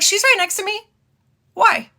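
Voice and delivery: answer, caught off guard; surprised high voice. Retold or imitated.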